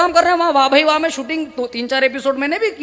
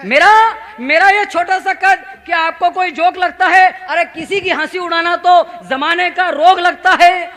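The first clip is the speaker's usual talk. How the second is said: slow, louder, higher declaiming of verse; dramatic poem recitation; 4 semitones higher, 7 dB louder, slower than usual. Acted. Poet reciting verses about his height to the audience, declaiming slowly. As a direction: recite it like a stage poem, drawn out.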